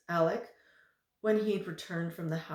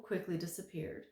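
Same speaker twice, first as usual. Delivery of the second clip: plain reading voice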